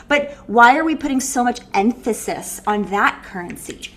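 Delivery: ordinary talk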